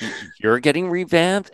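high, puzzled question rising at the end; surprised. Retold or imitated. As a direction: act baffled by the word revamped. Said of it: "And I was like".

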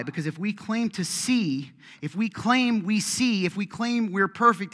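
rising sermon fervor; full-force preaching. Acted. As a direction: drive each claim home to the crowd.

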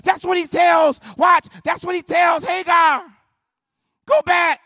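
loud, high, driving sermon shout; full-force preaching. Acted. Preacher voicing God's answers to the congregation, full-force preaching. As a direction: preach it at the top of the voice.

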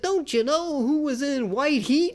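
high-pitched, insistent mock quizzing; imitating a movie know-it-all; pestering trivia nerd. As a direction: send the pitch up like an oblivious pest. Acting out Eric quizzing his boss.

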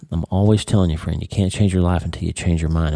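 ordinary delivery

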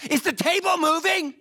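high, loud startled blurt; mock-startled wife asking if the table moves; blurted alarm. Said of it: "My wife just blurts out".

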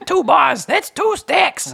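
very high, squeaky hustler voice; Danny Deep Pockets. Acted. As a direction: play a slick, grinning money changer. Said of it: "He goes".

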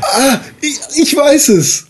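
excited realization. Real, not acted. Player suddenly has the answer for the group, excited. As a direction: blurt it out, thrilled to have the answer.

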